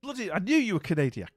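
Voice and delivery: loud, high burst of vindication; triumphant excitement. Real, not acted. Streamer suddenly remembers the friend is Canadian, loud triumphant delight.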